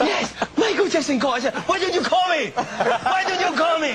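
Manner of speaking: high, laughing, excited pitch. Retold or imitated. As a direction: squeal it out in disbelief. Excited retold dialogue.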